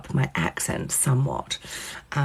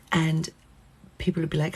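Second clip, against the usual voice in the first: ordinary talk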